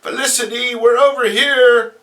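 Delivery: high, loud call across the street; high shouted hail. Acted. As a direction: call out to her friends from afar. Calling to friends across street.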